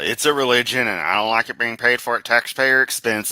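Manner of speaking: loud, put-on voice parodying Kent; hammed-up mimicry of a preacher's complaint; mocking Kent. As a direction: sneer out his talking point in a silly voice.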